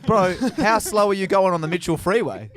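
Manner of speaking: high, loud comic disbelief; mock incredulous shout. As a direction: shout the question in playful outrage. Mock disbelief at the slow driving.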